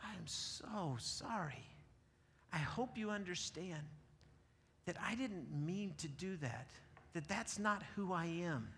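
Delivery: slow soft sincere sorry; sincere apology. Retold or imitated. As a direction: apologize gently from the heart.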